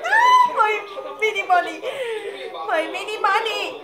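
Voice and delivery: high-pitched, melting fan coo; adoring squeal. Real, not acted. Fan cooing over a favorite idol, adoring squeal. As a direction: squeal fondly, pitch sliding down.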